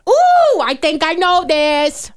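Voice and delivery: high loud ooh, then slow eager line; rising to the trivia challenge, excited and drawn out. Real, not acted. Trivia host asks, she eagerly jumps in.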